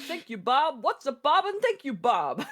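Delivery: high, chirpy robot politeness; mock chatbot voice. Echoing the looping bots in a high chirpy voice. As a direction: play a cheerful bot stuck in a loop. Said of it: "Hi, Bob".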